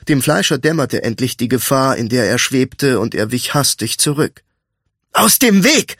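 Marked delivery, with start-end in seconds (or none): 5.1-6.0 high, angry shout; angry scolding. A narrator's reading. Thief snapping at the friend blocking his path, angry shout. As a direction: bark it as an angry order. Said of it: "schimpfte Royce".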